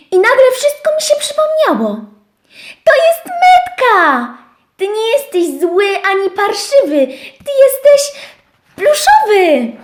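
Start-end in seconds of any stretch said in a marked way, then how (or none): 2.8-9.7 dużo wyżej i głośniej, wolniej, z przejęciem; podekscytowany okrzyk. Acted. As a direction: wykrzycz odkrycie wysoko i głośno. Owieczka odkrywa prawdę. (owieczka wykrzykuje szczurowi odkrycie, wysoko i głośno)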